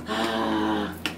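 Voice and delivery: short startled breath in; soft gasp. Real, not acted. Catching sight of something while unboxing, a sharp gasp. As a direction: gasp as if caught off guard.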